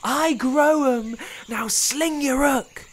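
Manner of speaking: high, slow, dismissive spider; grumpy high spider voice; rude old spider. A narrator's reading. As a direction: send them packing. Wanda dismisses them.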